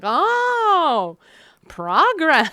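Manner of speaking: high, loud squeak of surprise; hammed-up astonished gasp; mock surprised. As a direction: pretend to be stunned by good news. Mock surprised realization quote.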